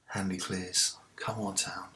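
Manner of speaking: unvoiced whisper to himself; whispered urging. Real, not acted. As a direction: hiss it under your breath for the team. Urging team under breath.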